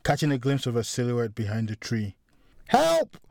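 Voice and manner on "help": high-pitched terrified woman's scream; screaming for help. A narrator's reading. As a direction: scream for your life, voice pitched way up. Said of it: "Saskia began to scream".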